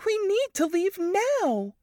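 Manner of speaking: high, slow, firm insistence; Varys insistent. A narrator's reading. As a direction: plead firmly to leave now.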